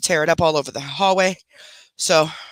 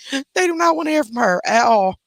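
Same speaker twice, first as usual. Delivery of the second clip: laughing; 7 semitones higher than usual. Real, not acted. Laughing about pets disliking voice.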